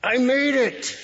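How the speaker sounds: high, loud shout of having made it; triumphant cry. Shown as a demonstration. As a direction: yell it from the top of the mountain. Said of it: "the cry of victory that you'd make".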